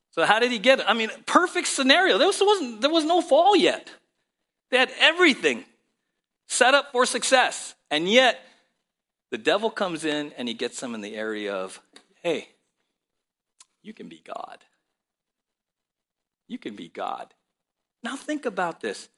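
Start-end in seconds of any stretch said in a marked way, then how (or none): 0.1-8.5 high, loud impassioned preaching; preaching at full force. Acted. Preaching climax again. 12.2-17.3 tempting devil. Retold or imitated.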